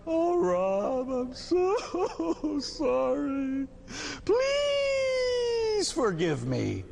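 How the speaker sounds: high, stretched-out fake wail; very slow, blubbering mock plea; mock sobbing apology. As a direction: beg forgiveness in hammed-up tears. Hammed-up second apology.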